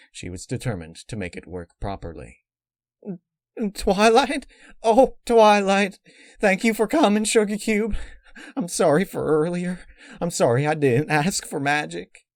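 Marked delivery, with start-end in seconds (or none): 3.7-12.3 high, loud, pleading mare voice; frightened dying mare. Acted. Apple Rush begging Twilight at her bedside, high and pleading. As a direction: cry out to her friend, high and strained.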